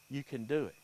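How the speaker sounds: usual speaking voice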